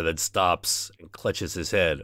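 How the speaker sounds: ordinary talk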